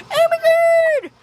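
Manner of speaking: squealing high mock excitement; mock fan squeal. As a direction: gush like a nostalgic fan, shouted. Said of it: "everybody is like".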